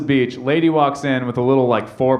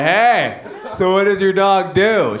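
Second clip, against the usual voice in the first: higher, slow, delighted question; gleeful grin; 5 semitones higher, slower than usual. Retold or imitated. Bystander tapping angry dog owner's shoulder, grinning eagerly. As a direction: ask through a big dumb grin. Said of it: "this dumb shit-eating grin on my face".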